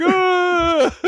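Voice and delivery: high squealing scream that breaks into a laugh; child's gleeful high squeal and laugh; mock scream and giggle. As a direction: shriek with delight, then laugh. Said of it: "drive off like".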